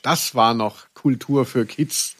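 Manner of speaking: ordinary talk, nothing marked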